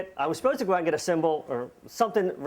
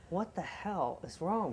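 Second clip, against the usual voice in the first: hushed alarm; 10 dB quieter, slower than usual. Retold or imitated. Hears screaming from back room.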